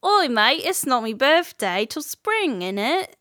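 a lightly put-on Cockney accent, cheeky. Cohost asked for Cockney, teasing Cockney accent. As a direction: play a jokey London Cockney lad. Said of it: "can I get a Cockney accent out of you".

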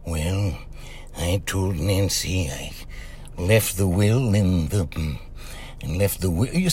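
low, soft, slow impression of an old-time president; Ronald Reagan. Radio host showing off his studio voices, Reagan impression. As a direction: drop the voice right down and take it slowly. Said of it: "Ronald Reagan. You know, I was like".

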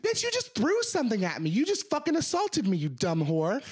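high-pitched angry yell at a rude customer; shrill up top, spitting the insults out; retelling a fight, voice jumping high with anger; heated retold yell. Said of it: "you're heated".

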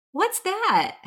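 high, sudden alarm; retelling the moment she spots blood; startled question. Retold or imitated. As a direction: shoot the voice up in shock. Finds blood on son's head.

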